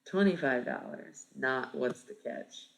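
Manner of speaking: usual speaking voice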